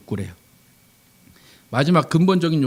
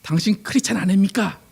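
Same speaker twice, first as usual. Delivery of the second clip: indignant challenge, pitched up; acted angry protest; 4 semitones higher than usual. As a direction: play an offended client firing back. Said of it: "화를 내면서".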